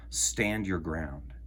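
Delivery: plain reading voice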